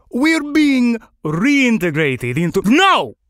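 high, exasperated outburst cut short; snappish. Acted. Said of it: "Hunger made Felix snappish".

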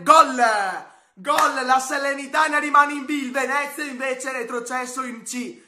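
high, loud goal call; breathless italian goal commentary. Real, not acted. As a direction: yell the goal with joy. Decisive goal in shootout.

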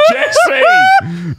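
high laughing yell; laughing shout. Real, not acted. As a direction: shout the name through laughter. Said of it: "This is why the New Zealand accent has problems".

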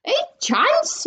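very high, incredulous yelp; yelled. A narrator's reading. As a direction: yelp it in outraged alarm. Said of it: "yelled Missus Salt".

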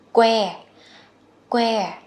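"Que" with a popped, breath-released k; aspirated k onset. Shown as a demonstration. Teacher drills learners on the breath, "Que" with a strong k release. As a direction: burst firmly into "Que". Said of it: "Mình bật cái hơi ra".

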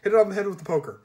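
loud, high, eager urging; excited child. Acted. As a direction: egg her on loudly.

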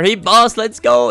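sudden high, loud whoop of victory; excited cheer. Real, not acted. To viewers as his opponent busts, excited loud cheer. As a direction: shout it out as the hand wins. Win celebration in gambling game.

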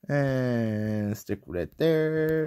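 ordinary talk

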